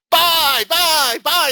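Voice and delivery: high, loud, urgent buy calls; screaming buy. Retold or imitated. [name] screaming buy at the traders in the room. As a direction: yell it across the room like the trade is slipping away. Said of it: "you hear me screaming".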